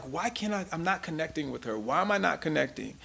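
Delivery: hushed, fast private musing; quietly asking yourself; puzzled inner thought. Retold or imitated. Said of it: "and I'm like".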